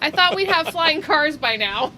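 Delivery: laughing. Real, not acted. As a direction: laugh as you say it. Laughing about flying cars.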